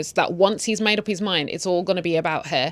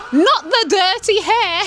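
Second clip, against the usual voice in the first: high, loud, drawn-out wail; slow scandalized cry, hammed up; mock horror; 11 semitones higher, 5 dB louder, slower than usual. Recapper reacting to the dirty hair insult, mock horror.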